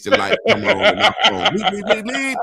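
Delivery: high laughing bleep bleep, mimicking the phone alarm; laughing phone beeps. Telling a friend about his ring cam, high laughing beeps.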